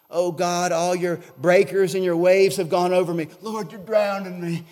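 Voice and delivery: loud cry to God; self-pitying lament. Acted. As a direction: cry out as if overwhelmed by waves. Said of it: "David is starting to feel s-, sorry for himself".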